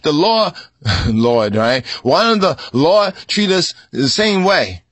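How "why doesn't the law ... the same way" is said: mock protest. Voicing protest again.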